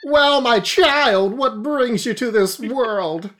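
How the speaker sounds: high pious preacher voice; a high, kindly priest greeting; Armadillon. Acted. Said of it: "It's Father Armadillo Don now".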